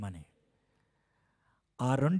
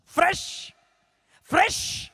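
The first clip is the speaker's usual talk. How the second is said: much higher, bright emphatic fresh; excited exclamation; 5 dB louder, slower than usual. Acted. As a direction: sell the newness.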